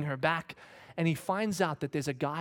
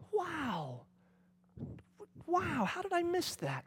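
much higher, slow and stunned; dismayed surprise; 14 semitones higher, 4 dB quieter, much slower than usual. Retold or imitated. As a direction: realise your mistake in shock. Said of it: "the kind that just kinda went".

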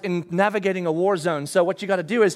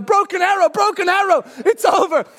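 higher, louder urgent radio call; mock distress call; 10 semitones higher, 6 dB louder than usual. Overrun dad calling for rescue: urgent shout. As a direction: call it in like the line has fallen. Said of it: "And I wanna go".

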